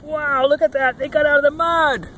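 high-pitched delighted wow; high, excited storybook cheer; excited amazement. Acted. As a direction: marvel aloud for small listeners. Reader exclaims as trucks get free.

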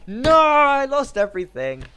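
high, loud, drawn-out cry; anguished wail. Real, not acted. Player losing all their stuff, high anguished wail. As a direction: wail slowly over a big loss.

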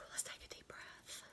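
sighing, whispered deep-breath cue; slow yourself down on a whispered sigh; breathy self-calming whisper. Real, not acted. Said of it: "I'll slow down".

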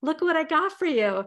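wife calling her husband to show a gift; eager wife. Retold or imitated. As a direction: call out to him proudly with a present. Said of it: "I'm like".

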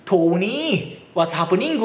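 drawn-out Irish lilt, rising at the end; thick Irish accent. Retold or imitated. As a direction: imitate heavy Irish-accented English, stretching the last syllable. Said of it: "すっごい訛りの子いてね". (語尾を伸ばし上げるアイルランド訛り)

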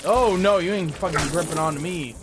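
loud, high yelp of alarm; loud startled cry; alarmed shout. Real, not acted. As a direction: shout in sudden alarm as it grabs you. Grabbed by something in game.

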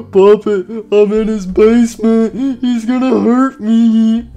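high, frightened whimpering plea; crying. Acted. Freddy tells Puppet he'll be hurt, tearful and scared. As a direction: tearfully plead for rescue. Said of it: "Stop crying".

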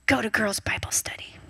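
voiceless hushed command; soft secret whisper; whispered prompt. Retold or imitated. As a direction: breathe it like a quiet inner voice. God's voice whispered.

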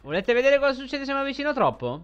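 high, loud, playful buildup; excited, teasing. Real, not acted. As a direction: hype up the audience. Showing the danger to viewers.

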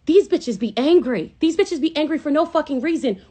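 loud, high mock sneer at angry women; mocking the haters. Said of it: "no one ever is just like".

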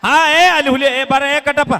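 high, loud summons; calling out. Real, not acted. Host calls a boy up to the stage, high shout. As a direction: shout someone over from across the crowd.

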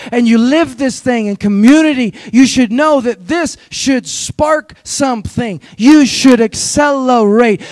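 lively preacher cadence; preaching. Acted. Pastor preaching to his church, rousing. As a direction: preach it with rising energy to the congregation.

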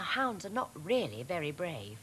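ordinary delivery, nothing marked

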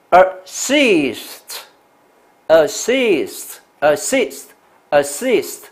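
assist very slowly, second syllable high and stretched; drawn-out, careful assist for dictation; stress on second syllable. Shown as a demonstration. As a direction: repeat assist as a slow model, leaning on the end. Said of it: "那重音在第二音节".

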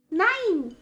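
very high, loud shouted no; child's shout. Acted. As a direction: shout it like a stubborn boy. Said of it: "schrie Häwelmann".

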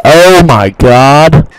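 loud, drawn-out outburst; loud exclamation. Real, not acted. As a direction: cry it out slow and loud. Startled during the game.

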